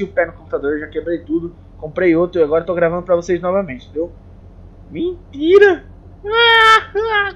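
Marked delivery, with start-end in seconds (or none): 4.9-7.3 high, loud drawn-out cry; mock outburst. Vlogger admitting to viewers he was joking, mock wail. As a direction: blurt it high and loud, then wail in jest.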